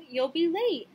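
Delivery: calling out. A narrator's reading. Mother hurrying Sammy to school, calling out. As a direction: call it out as a warning. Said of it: "his mother called".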